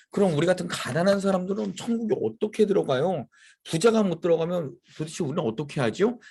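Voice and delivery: mock-anxious disciples; worried disciples. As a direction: ask as if panicking about heaven. Disciples anxiously asking Jesus.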